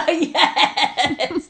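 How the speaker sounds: a high burst of real laughter on the word; laughing yes. Real, not acted. Host cracking up at her cohost's yacht joke, laughing. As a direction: laugh it out, delighted.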